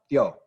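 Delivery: "tio" as one syllable with a glide, "thyo"; blended vowels. Shown as a demonstration. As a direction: run I into O in "tio". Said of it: "Blends together".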